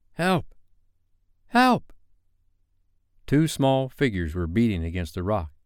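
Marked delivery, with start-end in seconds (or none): 0.1-1.9 high-pitched cry, the second louder; feeble cry for help. A narrator's reading. Small figures crying for help from the rock, feeble high cry. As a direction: cry out weakly, then higher and louder. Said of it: "and then a feeble cry".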